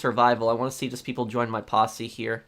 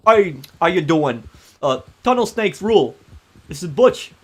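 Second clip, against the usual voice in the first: loud tough-guy character accent, raised pitch; Butch; 5 semitones higher, 7 dB louder than usual. Shown as a demonstration. Streamer previews next scammer-trolling character, Butch's accent. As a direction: belt out a cocky gang-member accent. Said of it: "doing the accent for".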